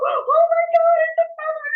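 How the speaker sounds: very high, loud re-enacted astonishment; retold amazement. Woman retelling finding a feather to a friend, astonished. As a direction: relive the discovery, squealing through laughter. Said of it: "I go".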